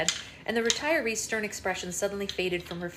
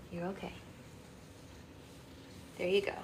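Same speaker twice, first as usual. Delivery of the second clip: plain reading voice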